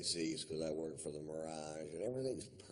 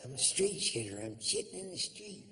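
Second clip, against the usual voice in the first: much higher eerie ghost voice; ghostly woman; 11 semitones higher than usual. Pretend. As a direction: wail like the desert ghost.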